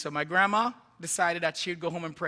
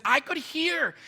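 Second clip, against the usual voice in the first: higher-pitched Jamaican storytelling voice; aunt's Jamaican accent; 8 semitones higher, 3 dB louder, slower than usual. Retold or imitated. Aunt retelling the clinic visit, animated Jamaican accent. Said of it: "she said in her Jamaican accent".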